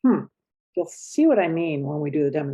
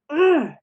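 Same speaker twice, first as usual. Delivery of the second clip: high, loud frustrated "Ugh"; a short burst of anger; exasperated ugh; angry grunt; 10 semitones higher, 6 dB louder than usual. Shown as a demonstration. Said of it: "I feel angry".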